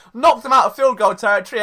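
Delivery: usual speaking voice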